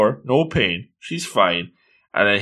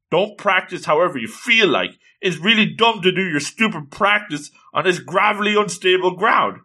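higher, mildly angry telling-off; angry lecture; 7 semitones higher than usual. Retold or imitated. Sakurai telling Toyoguchi off for training on gravel, mild angry lecture. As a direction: lecture her like a fed-up coach, lightly voiced. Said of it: "He's really angry".

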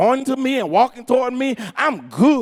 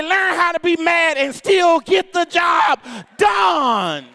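raised preacher's call, stretched at the end; full-force preaching; 4 semitones higher than usual. Acted. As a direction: build it to a rousing shout.

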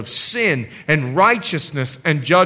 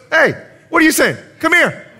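higher, fast, challenging call-out; bold confrontation; 10 semitones higher, 3 dB louder, faster than usual. Retold or imitated. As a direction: call them out head on. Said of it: "Jesus looks at him and goes".

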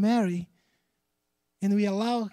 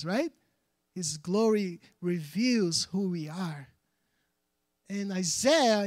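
usual speaking voice

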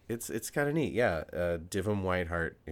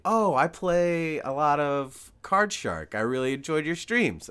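louder, higher, drawn-out fan gushing; retold excited fan; 8 semitones higher, 8 dB louder, slower than usual. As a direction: imitate a fan delighted to meet you. Retold fan greeting the speaker.

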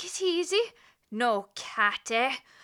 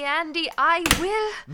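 loud, slow "Andy, I will", each word leaned on; slow loud threat; 2 semitones higher, 8 dB louder than usual. Acted. Officer threatens Andy over the cat, slow loud warning. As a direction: lay down a final warning, slowly.

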